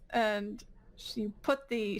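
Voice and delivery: usual speaking voice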